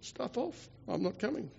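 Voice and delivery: quiet, offhand refusal; queen's refusal. Retold or imitated. Queen turns down the king's summons, quietly dismissive. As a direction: refuse softly, without raising your voice. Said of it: "She said".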